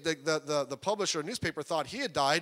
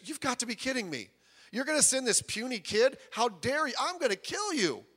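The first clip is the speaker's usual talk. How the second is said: higher, scornful taunt; mocking giant; 7 semitones higher than usual. The giant Goliath taunts young David, higher mocking voice. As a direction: sneer it down at a puny kid. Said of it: "Goliath looks at David and says".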